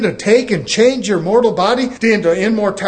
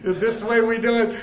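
laughing disbelief; 2 semitones lower, 8 dB quieter than usual. Retold or imitated. Retells his stunned reply.

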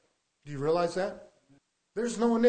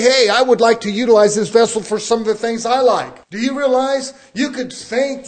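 preaching at full force; 2 semitones higher, 6 dB louder than usual. Real, not acted. Preacher warning his church about the flesh, loud and insistent. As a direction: press each question on the listeners.

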